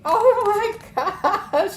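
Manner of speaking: giggling through the words; laughing. Real, not acted. Tarot reader laughing at the client's reading. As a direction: laughs out loud, high, mid-phrase.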